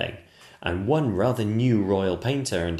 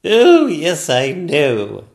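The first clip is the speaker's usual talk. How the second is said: loud, high regal agreement; a queen agreeing at full volume; exasperated Queen; 8 semitones higher, 11 dB louder, much slower than usual. Acted. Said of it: "said the Queen".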